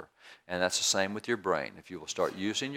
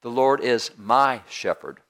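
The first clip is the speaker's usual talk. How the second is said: my punched loud and held; slow down and hit the word "my"; emphatic line that singles out "my"; stress on MY; 7 dB louder, slower than usual. Shown as a demonstration. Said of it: "take one word and just emphasize it".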